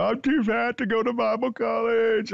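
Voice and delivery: high-pitched imitated wail; exaggerated crybaby retelling; mock crying. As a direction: wail it like a tearful phone confession, hammed up. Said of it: "And I was crying and everything".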